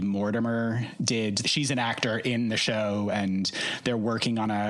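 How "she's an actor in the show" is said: ordinary delivery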